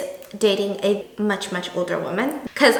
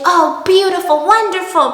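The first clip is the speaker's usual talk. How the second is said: high, louder sweet delight; delighted old lady; 6 semitones higher, 5 dB louder than usual. Retold or imitated. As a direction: gush like a thrilled neighbor. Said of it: "And so she's like".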